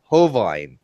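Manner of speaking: Hovind said as "ho-VINE"; mocking "Ho-vine" for Hovind; imitated mispronunciation. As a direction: end Hovind to rhyme with "vine". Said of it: "he calls him Ken Hovind".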